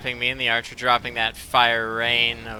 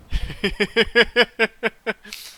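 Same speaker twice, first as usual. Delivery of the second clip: laugh; 8 semitones higher, 4 dB louder than usual. Real, not acted. Laughing at Altmer weapons joke.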